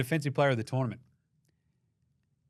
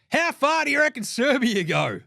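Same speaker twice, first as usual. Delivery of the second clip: high, louder, worked-up question; excited high outburst; 11 semitones higher, 5 dB louder than usual. Real, not acted. Arguing Jokic for MVP to co-host, high excited. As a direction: fire the question off, high and loud. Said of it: "what you just heard there was not far".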